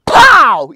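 shouted pow, loud; sound effect pow. Shown as a demonstration. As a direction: punch it like a whip crack. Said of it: "He grabs that cloak".

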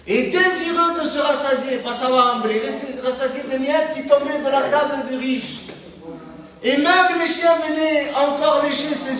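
solemn Bible reading from the pulpit; scripture reading. Acted. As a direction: lift the voice and read the verse like scripture. Reads the gospel passage aloud.

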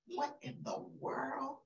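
sudden high squeal of disbelief; incredulous. Acted. Preacher aghast at the Galatians, high incredulous cry. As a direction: shoot the voice way up, stunned.

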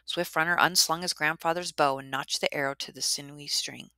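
usual speaking voice

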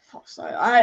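normal talking voice

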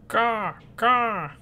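high, put-on cawing; imitated crow caw. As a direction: caw twice like a cartoon crow. Imitated cry in the comic.

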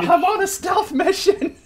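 high laughing shout. Pretend. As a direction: yell it, cracking up. Mock phone shout.

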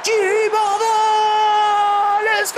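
high, loud, sung-out scream of the scorer's name; goal celebration yell. Real, not acted. Streamer celebrating a goal on stream, high sung shout. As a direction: belt it like your team just scored.

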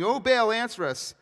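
loud, high cry to a false god; shouting prayer. A narrator's reading. Worshippers calling on Baal to answer, shouting.

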